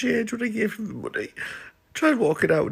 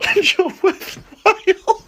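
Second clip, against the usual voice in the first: laughing; 14 semitones higher, 3 dB louder, much slower than usual. Retold or imitated. Retelling a compliment to husband.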